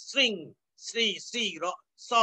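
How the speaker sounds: শৃং and শ্রী begun with English "s"; sring, sri, then a bare স as "s"; dental s sound. Shown as a demonstration. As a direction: isolate the sr- onset in শৃং, শ্রী. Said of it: "মানে দন্ত স এর উচ্চারণ".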